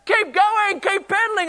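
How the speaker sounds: very high, loud parent cheer. Retold or imitated. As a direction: cheer your kid on across the park. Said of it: "we're cheering".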